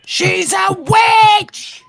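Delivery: high, slow, drawn-out shout; mock witch hunt shout. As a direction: cry it out like a mob.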